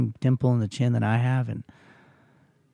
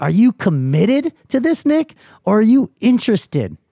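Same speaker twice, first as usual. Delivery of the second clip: higher, slow, pointed questioning; challenging himself; 6 semitones higher, 4 dB louder than usual. Retold or imitated. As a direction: put yourself on the spot, word by word.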